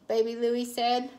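ordinary delivery

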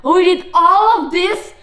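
loud disbelieving shout; indignant. Real, not acted. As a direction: protest in outrage. Outraged at rebirth.

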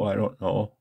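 ordinary talk, nothing marked

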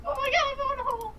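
very high, fast squeal; frantic falsetto-high panic; panicked squeal. Real, not acted. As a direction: squeal it in a rush to flee. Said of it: "Sorry, I screamed".

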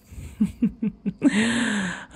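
a high, pleased little laugh; gloating laugh. Acted. Vampire gloating as the hunter weakens, pleased laugh. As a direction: savour the win with a laugh.

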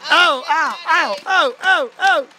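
high playful ows; hammed-up hurt yelps; mock pain. Hit by a Nerf dart.

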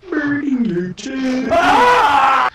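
high, slow shouted line. Real, not acted. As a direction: yell it out slowly. Repeating a game line louder.

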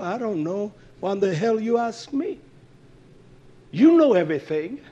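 mock indignant back-talk to God; everyday back-talk. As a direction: answer back like an affronted person. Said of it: "That's how people talk".

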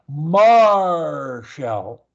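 "Marshall" with MAR held long and loud, then released; stretched first syllable. Shown as a demonstration. Coach showing Marshall as a sound launch, first syllable stretched. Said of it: "stretch it out and hold it".

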